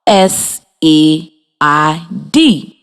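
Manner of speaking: slow letter by letter, T H E R E; teacher spelling a sight word for the class; spelled out slowly. Shown as a demonstration. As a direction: spell each letter apart for young learners. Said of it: "it is spelled".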